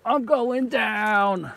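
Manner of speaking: slow, drawn-out falling cry; alarmed. Real, not acted. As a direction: draw it out as you slip. Falling into swamp.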